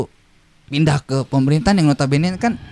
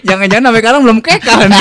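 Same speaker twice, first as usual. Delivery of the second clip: laughing; 4 semitones higher, 4 dB louder, faster than usual. Real, not acted. Laughs while teasing.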